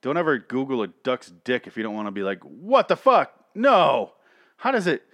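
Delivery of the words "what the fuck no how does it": shocked outburst. Pretend. Googling a duck's anatomy.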